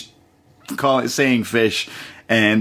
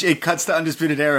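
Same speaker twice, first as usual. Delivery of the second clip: ordinary talk, nothing marked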